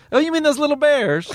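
high-pitched, mock-innocent question; incredulous stranger. Stranger asks an actor about his Ewok role, high and incredulous. Stranger misreading Ewoks as bears.

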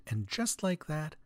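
ordinary talk

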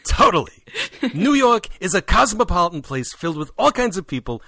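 brassy talk-show host delivery; Regis Philbin. Pretend. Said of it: "I'm trying to do Regis Philbin".

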